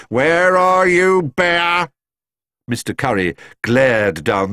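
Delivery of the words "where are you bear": slow, loud, high angry call; barking. A narrator's reading. Mister Curry calling down the road for Paddington, barking. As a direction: bellow for the culprit, stretching the words. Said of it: "he barked".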